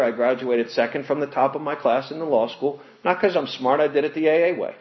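ordinary delivery

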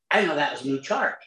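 ordinary talk, nothing marked

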